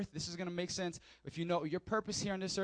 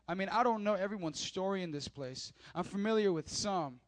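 normal talking voice

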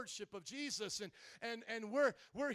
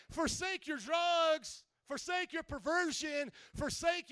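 high, forceful preacher shout; thundering command; 6 semitones higher, 4 dB louder, slower than usual. Acted. Preacher voices Jesus ordering the flock, thundering command. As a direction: belt out each command from the pulpit.

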